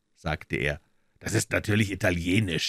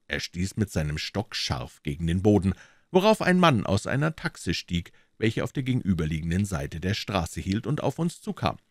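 ordinary delivery

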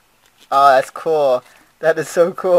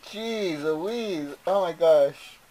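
ordinary talk

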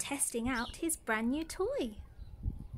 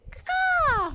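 much higher, loud caw; crow call; 8 dB louder than usual. Pretend. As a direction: squawk like a crow. Imitates crow.

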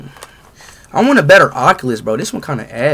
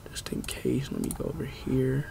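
quiet muttering; 2 semitones lower, 14 dB quieter, slower than usual. Real, not acted. Streamer muttering while setting up.

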